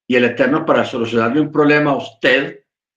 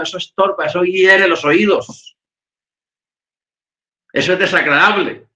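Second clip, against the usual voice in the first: usual speaking voice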